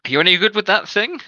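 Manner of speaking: loud, high challenging captain; guard captain voice. Acted. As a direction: test him, loud and high. Captain questions recruit.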